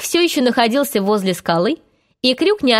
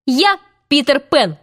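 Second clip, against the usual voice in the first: higher-pitched proud announcement; triumphant reveal; 4 semitones higher than usual. A narrator's reading. Peter reveals himself to Hook, triumphant.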